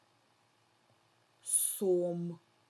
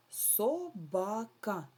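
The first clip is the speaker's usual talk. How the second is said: Собака said slowly, syllable by syllable, stress on the middle "ба"; teacher splitting a word for children; syllables drawn out; 3 semitones higher, much slower than usual. Shown as a demonstration. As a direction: stretch Собака into three syllables, lean on "ба". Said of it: "наголос падає у нас на середній склад".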